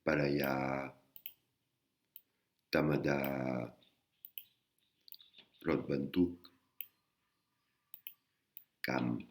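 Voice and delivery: palaya, tamada, rotbantuk, kam, banatikam sounded out slowly with long pauses between; slow teacher demo of Thai words. Shown as a demonstration. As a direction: spell out each Thai word by ear for learners. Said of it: "Comme dans le mot".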